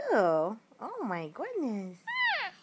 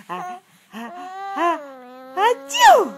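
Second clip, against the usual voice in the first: drawn-out ah ah building to a loud, high, hammed-up achoo; playful mock sneeze, much louder; fake sneeze; 4 semitones higher, 13 dB louder than usual. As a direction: ham up a big pretend sneeze to make the baby laugh. Parent fakes sneezes for baby.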